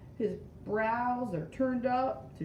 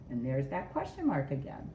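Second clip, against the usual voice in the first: usual speaking voice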